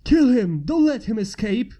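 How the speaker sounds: loud pursuer's shout. A narrator's reading. Rebel shouting at the prince: loud shout. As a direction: yell it at the fleeing prince. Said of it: "Another voice shouted".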